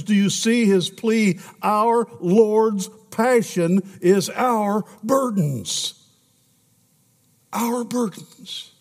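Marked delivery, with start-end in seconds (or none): 1.6-8.7 slow emphasis. Acted. Preacher slows on emphasis.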